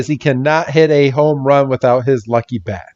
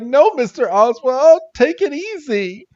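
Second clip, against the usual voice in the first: a much higher voice soothing him; high mock calming; 12 semitones higher than usual. Chef calms the eager scientist in a high voice. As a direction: settle him down in a high squeaky voice.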